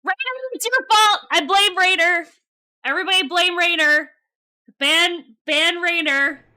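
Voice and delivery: high, loud playful blaming; rally everyone with mock outrage; hammed-up high shouted accusation; mock accusing shout. Streamer mock-blames someone, calling out.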